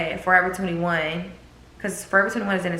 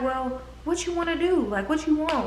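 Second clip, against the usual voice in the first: high, gentle imitation of the guy friend; guy friend's voice; 10 semitones higher than usual. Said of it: "And he was like".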